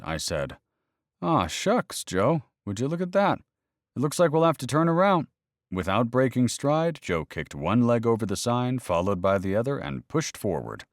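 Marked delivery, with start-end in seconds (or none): 1.2-5.3 high, hammed-up aw-shucks disappointment; theatrical fake regret, pitched high; mock dismay. Narrator mocks fake dismay.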